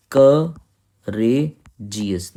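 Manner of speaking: "क ... रे ... जी एस" broken into separate syllables with pauses. Shown as a demonstration. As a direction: punch "क", pause, then draw out "रे", pause, "जी एस". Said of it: "फिर रे में pause हो रहा है". ("क ... रे ... जी एस" को रुक-रुक कर, अक्षर तोड़कर बोलें)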